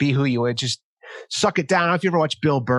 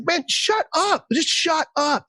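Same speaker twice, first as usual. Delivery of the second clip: much higher, slow, exasperated mock snap; imitating Bill Burr; 14 semitones higher, slower than usual. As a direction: play Bill Burr telling a guy to shut up. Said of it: "I was just like".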